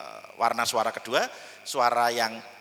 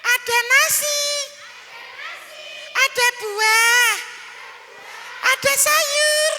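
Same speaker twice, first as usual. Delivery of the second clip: put-on small child voice; 4 dB louder, slower than usual. Shown as a demonstration. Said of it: "Yuk ulangi".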